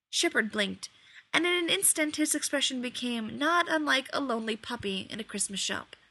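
ordinary delivery, nothing marked

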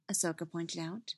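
plain reading voice

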